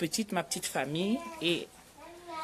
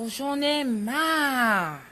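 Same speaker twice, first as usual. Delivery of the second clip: mock cry of frustration; 6 semitones higher, much slower than usual. Shown as a demonstration. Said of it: "on a envie de crier fort".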